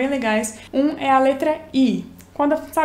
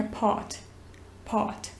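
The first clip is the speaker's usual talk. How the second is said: softer British part with the R dropped, pot; British accent; 3 semitones lower, 10 dB quieter than usual. Shown as a demonstration. Teacher demonstrating the British R. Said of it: "se você falar no britânico".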